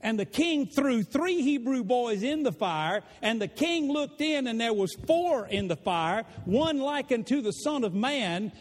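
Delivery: usual speaking voice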